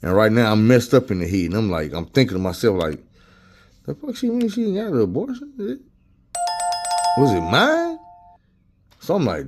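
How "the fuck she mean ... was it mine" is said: shocked thought. Retold or imitated. Shocked inner thought, abortion news.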